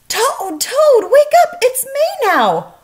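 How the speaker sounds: high, loud calls building to a shout; excited Frog shout. A narrator's reading. Frog waking Toad at his bedside, loud and high. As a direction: wake him up with a loud high call. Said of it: "Frog ran back to Toad's bed".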